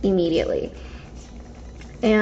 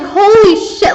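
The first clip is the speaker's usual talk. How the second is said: retold startled gasp, higher and louder; startled yelp; 9 semitones higher, 7 dB louder than usual. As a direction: jump like someone caught you off guard. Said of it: "like, I freaked out".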